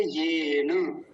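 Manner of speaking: येन with a long, held first vowel; drawn-out pronunciation. Shown as a demonstration. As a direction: stretch the ये of येन very slowly. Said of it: "येन नहीं बोलेंगे".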